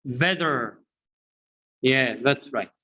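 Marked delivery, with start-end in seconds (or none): 0.0-0.8 better said bedder; American flap T. Shown as a demonstration. Teacher drills class on better as bedder.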